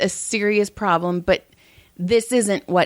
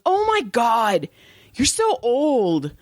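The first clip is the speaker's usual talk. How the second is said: put-on higher, drawn-out teenage disgust; imitating an exasperated son; mock exasperated kid; 5 semitones higher, slower than usual. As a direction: stretch it out, appalled at mom. Retold son's disgusted reaction.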